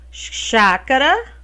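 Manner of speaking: ordinary talk, nothing marked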